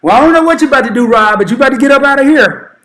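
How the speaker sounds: high, loud and fast stern mother voice; mama telling her grown son to get out, rapid and loud; loud, quick mama warning with a laugh in it; mama laying down the law. Retold or imitated.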